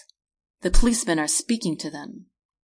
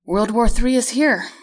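ordinary delivery

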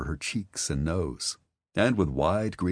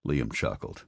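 ordinary delivery

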